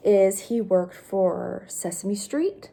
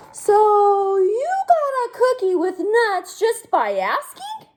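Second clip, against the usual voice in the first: high, rising disbelief; can't believe it; incredulous pigeon; 10 semitones higher, 3 dB louder than usual. Acted. Pigeon disbelieving the duckling, voice climbing.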